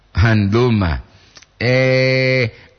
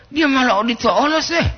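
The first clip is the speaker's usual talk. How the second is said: much higher-pitched exclamation; heightened intonation; 13 semitones higher than usual. Real, not acted. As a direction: push the pitch way up, just as intonation. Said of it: "intonasi poli itu, Pak, intonasi poli, jangan ingo-ingo". (intonasi tinggi)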